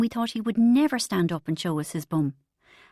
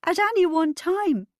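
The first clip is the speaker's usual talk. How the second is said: higher, louder, stammering; heaving with laughter; 10 semitones higher, 5 dB louder than usual. Acted. Narrator explaining the joke to Bridget, shaking with laughter. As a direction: push words out between laughs. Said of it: "pound with my fist".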